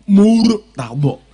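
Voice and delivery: "Murtado" slow and loud, lips pushed forward; Arabic pronunciation. Shown as a demonstration. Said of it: "bonya mulutnya agak ke depan".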